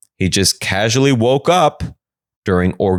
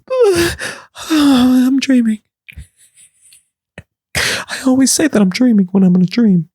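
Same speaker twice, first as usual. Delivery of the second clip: high crying sister. Acted. Sister convincing herself it's a dream, high and whimpering.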